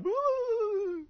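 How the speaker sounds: high, loud whooshing wind cry. Retold or imitated. Preacher mimicking wind shaking a reed, high whoosh. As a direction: blow like a gust through the reeds.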